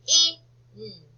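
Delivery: K-I-N as three separate letter sounds; phonics-style K... I... N; sounded out. Shown as a demonstration. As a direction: sound out K-I-N, a gap after each sound. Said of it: "think about the next syllable".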